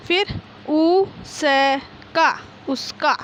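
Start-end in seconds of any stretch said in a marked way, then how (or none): 0.7-2.3 drawn out syllable by syllable. Shown as a demonstration. Teacher sounding out उसका slowly for class one pupils. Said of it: "बोल बोल कर पढ़िए".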